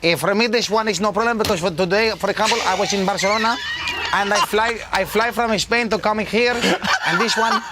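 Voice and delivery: easygoing Spanish-accented English. Shown as a demonstration. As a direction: talk like a Spaniard fresh from Barcelona.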